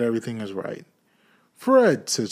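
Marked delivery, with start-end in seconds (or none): 1.6-2.1 high, loud hail that falls away; sudden ringing call, pitch swooping down. A narrator's reading. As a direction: call his name across a room. Scrooge calls out to nephew.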